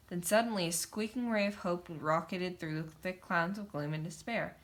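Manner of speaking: ordinary delivery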